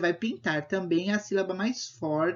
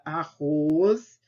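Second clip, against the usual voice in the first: "arroz" with the final "roz" stretched and louder; stress on last syllable; 5 dB louder than usual. Shown as a demonstration. Teacher showing pupils the strongest syllable, "arroz" with "roz" drawn out. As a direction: lean hard on the "roz" of "arroz". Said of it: "a sílaba mais forte é o ros".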